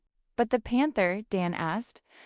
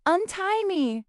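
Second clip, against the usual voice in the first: high frustrated shout; frustrated yell; 10 semitones higher, slower than usual. Acted. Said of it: "Bibi yelled in frustration".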